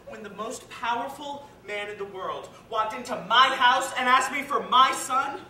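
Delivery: building to a loud, high shout; anguished outburst. Acted. Father's anguished, defensive outburst.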